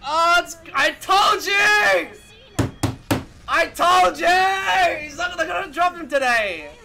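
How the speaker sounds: drawn-out, loud yell; excited I-told-you-so yelling; vindicated shouting. Real, not acted. Shouting vindication during reveal.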